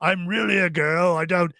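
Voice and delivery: a hint of a British woman's accent, played for laughs; lightly put-on female British accent; deliberately bad British lady accent; mock British woman. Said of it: "best British accent ... worst British accent".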